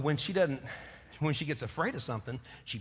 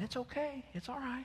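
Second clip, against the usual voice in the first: higher, much softer coaxing; soothing pet; 9 semitones higher, 10 dB quieter than usual. Retold or imitated. Owner coaxing his barking puppy, high and hushed. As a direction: sweet-talk the dog calm.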